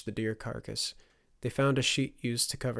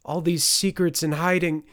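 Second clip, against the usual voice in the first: raised voice; 7 semitones higher, 8 dB louder than usual. Acted. Mother's heated argument with son.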